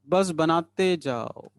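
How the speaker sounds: slow singsong urging, falling at the end; drawn-out coaxing. Real, not acted. Teacher urging students to keep drawing, slow singsong. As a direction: stretch it out like a refrain. Teacher chanting while class draws.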